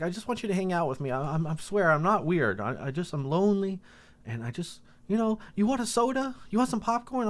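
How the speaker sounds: high-pitched needy man; retold tearful pleading. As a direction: plead in a high, lonely imitation voice. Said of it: "he starts crying".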